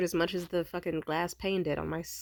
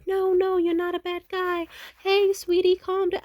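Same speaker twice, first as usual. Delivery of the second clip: very high, sugary placating voice; mock fawning soothing; 13 semitones higher, 5 dB louder than usual. Girlfriend placating angry ex, high sugary mock soothing. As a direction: coo at him to calm him down. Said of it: "like, fawn response".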